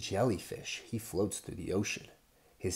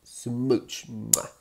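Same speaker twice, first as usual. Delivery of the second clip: louder smacking kiss noises; loud smooch kiss; 3 semitones higher, 7 dB louder than usual. Acted. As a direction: give big playful kisses. Kissing sounds.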